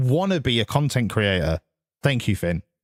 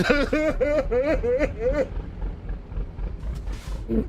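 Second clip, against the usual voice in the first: long laugh; 9 semitones higher, 4 dB quieter than usual. Real, not acted. Laughs at own joke.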